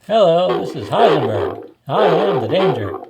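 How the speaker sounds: loud morphing voice changer; Heisenberg voice changer. Real, not acted. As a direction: speak through a voice changer helmet. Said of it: "Voice changer activated".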